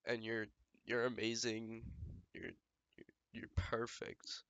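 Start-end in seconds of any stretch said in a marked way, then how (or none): 2.3-4.4 whispering. Real, not acted. Tender whispered compliment.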